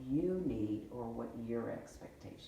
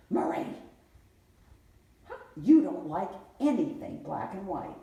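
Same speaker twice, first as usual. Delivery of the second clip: louder mock scolding of Marie; retold scolding; 5 semitones higher, 9 dB louder than usual. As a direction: scold her like grandma would. Quoting grandma scolding.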